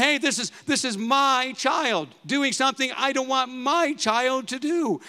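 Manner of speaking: high-pitched, acted parental protest; indignant parent. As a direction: object like a possessive parent, stressing "my". Acted parent objecting to child's choice.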